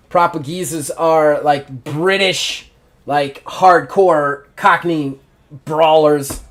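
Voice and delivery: usual speaking voice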